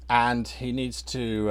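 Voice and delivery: normal talking voice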